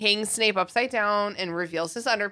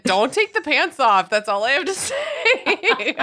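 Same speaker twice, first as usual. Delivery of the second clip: laughing protest; 6 semitones higher than usual. Real, not acted. Mock exasperation, breaking into laughter.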